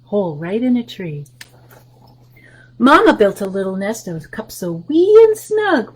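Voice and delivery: normal talking voice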